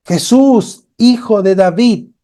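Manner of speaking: high, loud cry to Jesus from far off; blind man crying out. A narrator's reading. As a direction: call out desperately over the crowd. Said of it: "comenzó a gritar".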